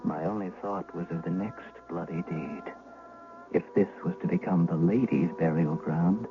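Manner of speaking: ordinary talk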